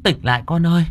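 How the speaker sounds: rising high, anxious plea; alarmed calling. A narrator's reading. Bà Hỏa calls to convulsing Đào Nương, alarmed.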